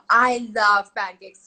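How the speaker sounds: loud, stretched-out mimicked delight; gushing little niece. Niece hears aunt is making pancakes, gushing delight. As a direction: gush it slowly and loudly like a thrilled child.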